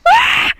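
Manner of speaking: piercing high, loud fake scream. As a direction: scream to cover up the other sound. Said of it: "I screamed as a distraction".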